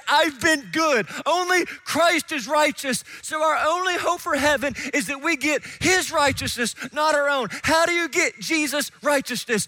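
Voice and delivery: rising into a loud, high preacher's shout; full-force preaching. Acted. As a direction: preach it out to the whole room, building.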